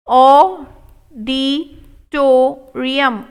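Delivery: auditorium stretched very slow; slow model take. Shown as a demonstration. Teacher modelling auditorium slowly for students. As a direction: pull every syllable long. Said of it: "slow model take".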